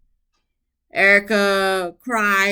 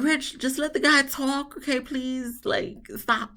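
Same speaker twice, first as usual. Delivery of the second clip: high, pleading hush to Rich; higher voice telling Rich to drop it; exasperated plea; 8 semitones higher, 4 dB quieter than usual. Retold or imitated. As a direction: beg him to stop, tired of it. Speaker scolds Rich in his retelling.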